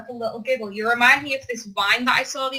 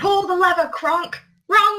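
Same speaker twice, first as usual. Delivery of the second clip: shouted movie quote; 6 semitones higher, 3 dB louder than usual. Retold or imitated. Quoting Yzma movie line.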